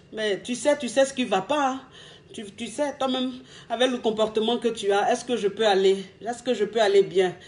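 wounded, soft-spoken reproach; hurt and subdued. Shown as a demonstration. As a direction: quietly make him feel your pain. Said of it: "il faut qu'ils sentent que tu es triste".

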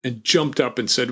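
normal talking voice

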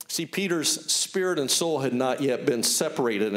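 usual speaking voice

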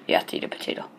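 whispered aside to the viewers. Acted. Gamer whispering a tip to his viewers. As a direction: breathe it as a secret.

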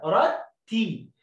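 each word drawn out very slow; teacher stretching يرتي; slow blending. Shown as a demonstration. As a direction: blend syllables slowly. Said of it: "زي كلمة يو لوحدها".

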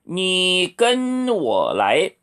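very slow, syllable by syllable; slow model sentence. Shown as a demonstration. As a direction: model it slowly for learners. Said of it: "이렇게 하세요".

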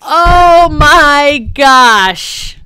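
loud, very drawn-out wail; anguished wail. Real, not acted. Gamer who died twice in one episode, wailing. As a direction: stretch every word out in loud despair. Despair after dying in game.

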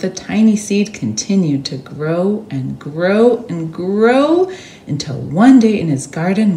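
ordinary delivery

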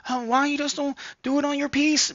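put-on high voice of a critic; mock complaining viewer. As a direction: imitate a nagging commenter in a high voice. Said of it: "I know some".

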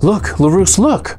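urgent call at moderate volume. A narrator's reading. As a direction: call the halfling's attention, pitch rising. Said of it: "you call".

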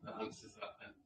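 quiet, distant mic sound; speaking far from the microphone. Real, not acted. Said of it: "put your mic next to your mouth".

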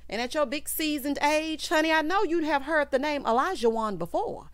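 ordinary talk, nothing marked

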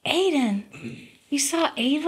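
can't believe what you're hearing; amazed question. Retold or imitated.

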